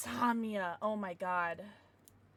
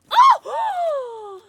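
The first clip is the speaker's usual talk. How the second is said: surprised shout; 18 dB louder than usual. Real, not acted. Opening a surprise gift.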